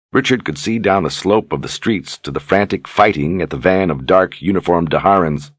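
ordinary delivery, nothing marked

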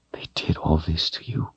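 stunned breathless whisper; barely voiced, horrified. A narrator's reading. Richard horrified at her story.

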